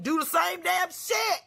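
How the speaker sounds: high, slow yell; shouting the obvious meaning; exasperated shout. Real, not acted. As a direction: spell it out at full volume.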